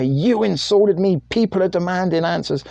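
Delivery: high-pitched mock outrage; mock indignation. Angry posts blowing up on Facebook, mock indignant.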